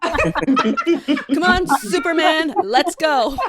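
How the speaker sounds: rally the reluctant hero; mock urging. Fan urging Superman to save America, rousing.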